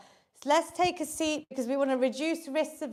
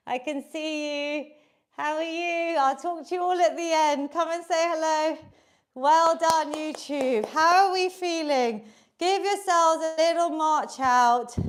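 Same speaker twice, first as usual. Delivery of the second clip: ordinary talk, nothing marked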